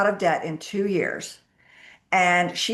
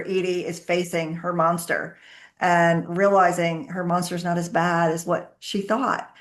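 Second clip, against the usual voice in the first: ordinary delivery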